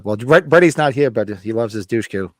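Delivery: usual speaking voice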